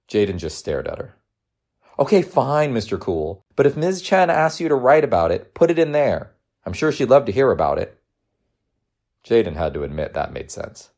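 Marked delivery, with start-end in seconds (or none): 2.0-8.0 higher-pitched mom conceding; playful motherly advice; teasing, encouraging mother. Acted. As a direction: give in, then advise.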